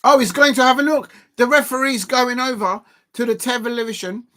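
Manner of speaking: loud, high rush of excitement; breathless live reaction; excited commentary. Real, not acted. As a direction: call it like the big moment is here. Referee goes to VAR monitor.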